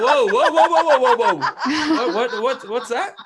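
high, loud, laughing alarm; half-laughing panic at a shocking accusation; startled whoa. Retold or imitated. Retelling being accused of killing a dog, he lets out a loud laughing whoa.

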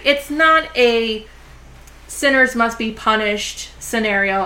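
ordinary talk, nothing marked

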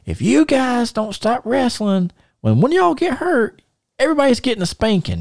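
scolding mom. Retold or imitated. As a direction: play the fed-up mother warning the kids. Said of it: "she's like".

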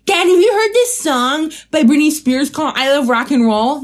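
high, eager call to Dad; excited kid's question. Retold or imitated. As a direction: play an overexcited kid telling Dad. Voices younger self asking dad excitedly.

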